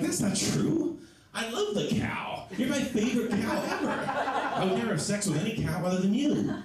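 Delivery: high-pitched, hammed-up reassurance; trying to be positive. Boyfriend trying to be positive to girlfriend, high hammed-up reassurance. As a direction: plead sweetly in a comic high voice. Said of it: "I'm trying to be positive".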